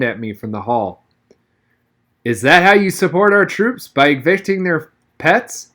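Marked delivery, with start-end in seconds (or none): 2.2-5.7 higher, louder mock-stern teasing; teasing dad. A narrator's reading. Grinning dad teases his son about the dog, mock-stern. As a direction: needle him playfully.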